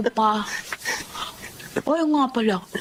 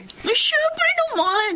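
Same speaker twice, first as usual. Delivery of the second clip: high, slow gleeful laugh through the words; laughing with delight; 14 semitones higher, much slower than usual. Acted. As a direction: gloat with a giddy laugh. Gleeful scheming friend.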